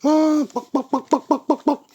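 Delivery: high, rapid bock-bock clucking; hen clucking. Pretend.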